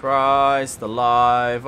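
slow, loud, stretched-out exclamation; drawn-out exclamation. Real, not acted. Player reacting to the horror game, loud drawn-out exclamation. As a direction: drag out each word loudly.

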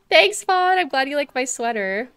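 loud, high, excited thank-you; bright thanks. Real, not acted. Thanking a viewer for liking the sweater, loud and excited. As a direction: beam it out.

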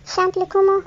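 mild grumbling retort; a touch of irritated complaint. Acted. The repairman grumbles back at the children, mildly cross. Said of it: "rouspéta monsieur Bricoleur".

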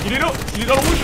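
voix haute et forte, en pleine action; excité. Real, not acted. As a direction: annoncer l'ennemi, tendu. Signale l'ennemi blessé. (appel excité)

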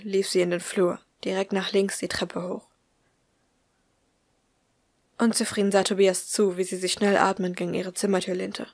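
ordinary delivery, nothing marked

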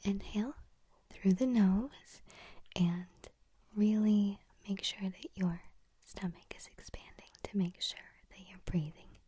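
guided breathing cue; breathing instruction whisper. Shown as a demonstration. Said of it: "repeat after me".

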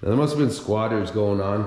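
usual speaking voice

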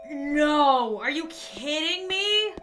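loud, exasperated disbelief; furious at having to start over; frustrated outburst. Real, not acted. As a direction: let the frustration burst out. Frustrated at losing progress.

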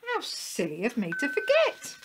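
high, lilting exclamation; eager upward-ending dog voice; amused self-reproach. A narrator's reading. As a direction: laugh off your own forgetfulness. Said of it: "laughed Willie".

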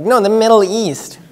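high, loud mimicked blurting; emphatic broad guess. People going broad after failed guesses, mimicked emphatically.

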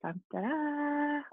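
Dun, da, da sung high and soft, slow; a little reveal jingle; playful fanfare. Shown as a demonstration. Teacher building suspense for a picture, high and soft. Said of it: "But look at this".

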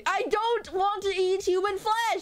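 high-pitched comic self-defence; indignant protest. Real, not acted. Protesting being taken for a cannibal.

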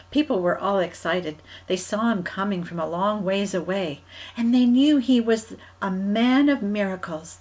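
ordinary delivery

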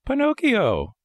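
very high little puppet voice; high-pitched puppet question; Pinocchio. A narrator's reading. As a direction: ask as a small, eager marionette. Puppet gives his name.

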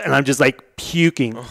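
ordinary talk, nothing marked

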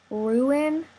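normal talking voice

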